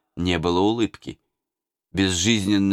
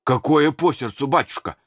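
moaning; 6 semitones higher than usual. A narrator's reading. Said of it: "простонал Михеич".

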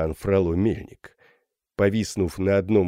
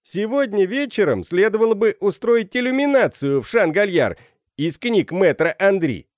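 high, raised voice of a rowdy student; inciting call; 11 semitones higher, 4 dB louder than usual. A narrator's reading. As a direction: egg the crowd on. Students inciting each other. (задорный призыв школяра)

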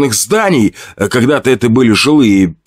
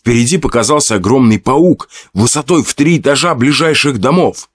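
ordinary delivery

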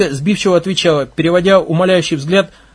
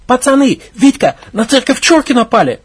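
high urgent alarm, raised voice; breathless warning to the lads; alarmed cry; 6 semitones higher, 4 dB louder than usual. Acted. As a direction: burst in shouting the news. Girl bursts in alarmed.

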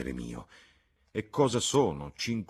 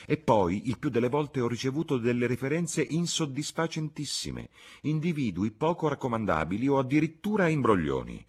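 high-pitched, mounting complaint; aggrieved gentleman airing his troubles; indignant lament; 7 semitones higher, 4 dB louder than usual. Acted. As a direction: bemoan it with growing exasperation. Landlord laments dishonest applicants.

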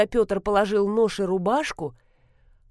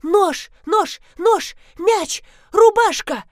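piercing high scream, much louder; 11 semitones higher, 8 dB louder, slower than usual. A narrator's reading. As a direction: scream each word at full force. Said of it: "вдруг заорала так, что зазвенели стёкла".

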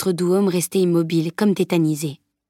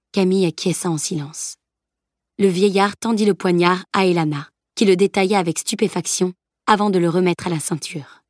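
ordinary delivery

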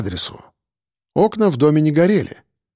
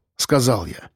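ordinary delivery

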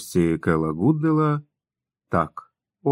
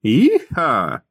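high, gleeful cowboy holler; high-pitched exclamation of joy; excited whoop; 5 semitones higher, 4 dB louder than usual. A narrator's reading. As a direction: whoop it out like a rider. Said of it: "вигукнув він".

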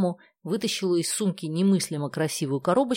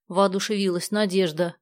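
usual speaking voice

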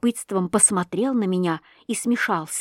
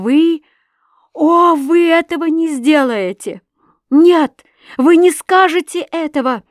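high, loud cry of alarm; high, loud pleading outcry; agitated outcry; 8 semitones higher, 8 dB louder than usual. A narrator's reading. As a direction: cry out, flushed with shame and upset. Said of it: "Вскрикнула Александра Михайловна, вся в волнении".

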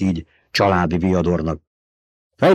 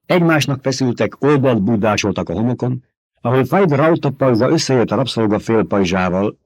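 usual speaking voice

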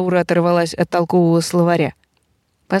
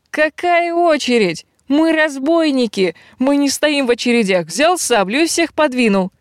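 indignant; 7 semitones higher than usual. Acted. Bandit berates servant.

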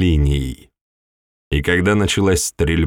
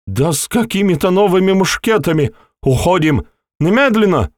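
frantic retreat order; 10 semitones higher than usual. A narrator's reading. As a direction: yell the order to flee in panic. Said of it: "генерал вновь выкрикнул".

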